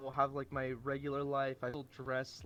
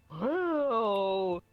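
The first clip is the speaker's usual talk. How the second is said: mock amazed whoa; 5 semitones higher, 7 dB louder than usual. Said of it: "everyone's all like".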